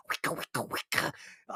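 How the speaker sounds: breathy whispered wicka wicka scratch noise; mock record scratch. Host mocking Smash Mouth samples with whispered scratches. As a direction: mimic a DJ scratch under your breath.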